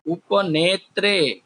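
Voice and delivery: उपनेत्रे said slowly with falling end; teacher dictating the dual; slow clear recital. Shown as a demonstration. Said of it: "दो हो तो उसे कहेंगे".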